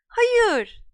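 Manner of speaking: high, loud alarmed cry. Acted. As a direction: cry out to stop him. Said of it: "diye haykırdı Hermione Granger".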